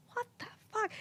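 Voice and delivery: very soft, high imitation of shock; stunned disbelief. Friend witnessing the request for the first time, stunned disbelief. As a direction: mimic a friend aghast under her breath.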